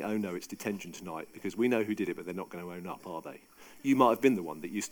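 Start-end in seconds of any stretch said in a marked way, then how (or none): 0.0-3.4 much softer, lower muttered worry; inner dread. Retold or imitated. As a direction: think it to yourself, sinking away. Pupils' dread thoughts in classroom.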